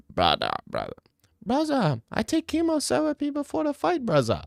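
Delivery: squeaky-high bad Dagestani accent; mixed-up mock Dagestani; terrible Dagestani accent. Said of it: "like, a Dagestani with, like, a speech impediment".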